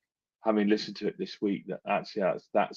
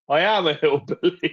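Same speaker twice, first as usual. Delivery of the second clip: put-on hillbilly accent; 6 semitones higher, 4 dB louder than usual. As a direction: play the hillbilly with a laugh. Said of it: "you sound like a hill, hillbilly".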